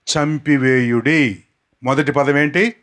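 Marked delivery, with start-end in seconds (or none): 0.1-1.4 slow, word by word for the crowd to repeat; each word laid out slowly; slow dictation. Shown as a demonstration. Preacher slowly reading the verse for the crowd. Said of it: "చెప్తాను, అవి నాతో పాటు పలకటానికి".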